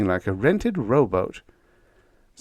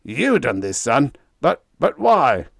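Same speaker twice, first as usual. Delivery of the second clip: higher, louder stammering disbelief; stunned skipper; 5 semitones higher, 5 dB louder than usual. A narrator's reading. Captain shocked at Biggs, stammering. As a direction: sputter it in shock. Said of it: "croaked the skipper".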